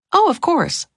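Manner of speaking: high, smiling agreement. A narrator's reading. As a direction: agree readily with an audible smile. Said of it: "she smiled".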